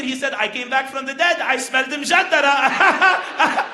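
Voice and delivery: put-on Lebanese accent building to high and loud; old Lebanese man, excited. Old man reveals uncle at the door, excited Lebanese accent.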